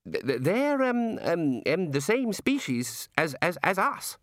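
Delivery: halting, embarrassed misery; miserable stammering. A narrator's reading. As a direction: stumble unhappily through the explanation. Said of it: "said Ponder miserably".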